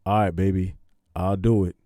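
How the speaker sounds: low, loud husband voice; husband answering his wife, low and loud; husband's reply. Acted. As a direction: drop the pitch for an agreeable husband. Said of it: "And then Jimmy say".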